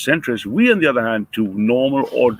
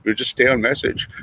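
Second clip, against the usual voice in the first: ordinary talk, nothing marked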